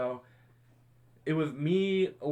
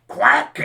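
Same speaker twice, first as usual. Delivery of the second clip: sharp breathy mock quack; imitated duck quack; 12 dB louder than usual. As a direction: blast out a duck call. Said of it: "Larry just goes".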